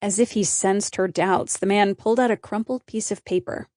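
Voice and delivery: usual speaking voice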